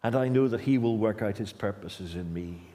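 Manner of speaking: usual speaking voice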